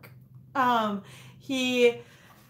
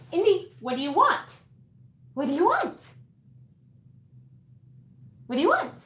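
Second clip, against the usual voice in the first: higher questioning voice to the dog; asking the dog; 7 semitones higher, 4 dB louder than usual. Acted. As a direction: ask the dog what he wants, lilting. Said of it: "if I say".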